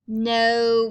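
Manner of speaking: usual speaking voice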